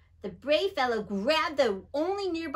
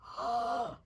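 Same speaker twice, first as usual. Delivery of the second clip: mock gasp; 3 dB quieter than usual. Reacting to story moral.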